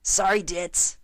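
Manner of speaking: mild shout. Acted. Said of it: "she shouted".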